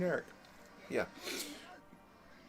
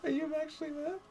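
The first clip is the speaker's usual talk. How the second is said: laughing tease; 12 semitones higher, 3 dB quieter than usual. Real, not acted. Laughing tease after tickling.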